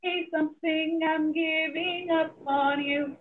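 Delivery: hollow, echoey phone sound; like talking from inside a tunnel; echoing tunnel. Real, not acted. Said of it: "you sound like you're in a tunnel".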